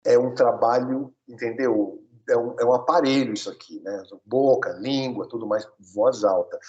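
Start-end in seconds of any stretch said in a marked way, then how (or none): none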